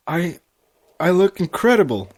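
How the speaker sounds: stunned by her own reflection; halting then bursting with wonder; awed gasp. Acted. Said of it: "Twilight gasped".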